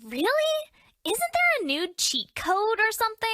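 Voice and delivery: high, indignant disbelief; indignant surprise. Acted. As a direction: squeak out mock outrage at the outfit. Character reacting to bikini outfit.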